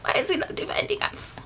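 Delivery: whispered, same question again. Retold or imitated. Child repeating the question to herself, whispered. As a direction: breathe it frightened under your breath. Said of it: "I'm like".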